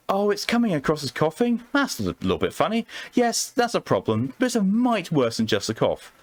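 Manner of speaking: a hint of a Southern charmer calling him formal; Southern belle accent. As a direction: play a coy Southern woman flirting with the doctor. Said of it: "God, I hate this accent".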